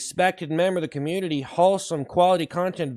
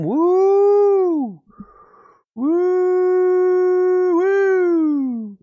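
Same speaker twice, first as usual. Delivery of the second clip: excited cheering; 12 semitones higher, 5 dB louder, much slower than usual. Acted. Repeated hype cheers.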